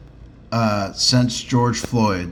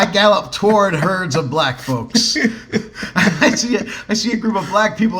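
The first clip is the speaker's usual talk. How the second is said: laughing; 8 semitones higher than usual. Real, not acted. Laughing while telling eager story.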